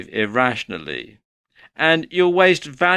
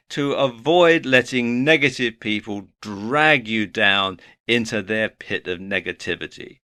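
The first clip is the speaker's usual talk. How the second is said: ordinary talk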